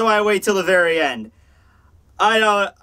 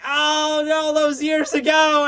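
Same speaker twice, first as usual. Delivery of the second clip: dramatic dying gasp; 8 semitones higher, much slower than usual. Pretend. Dying man sees face, dramatic.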